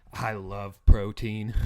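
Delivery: slow, drawn-out dopey voice; mock Haley. Mocking Haley's date line, slow and dopey. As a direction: drag out each word like a dim date.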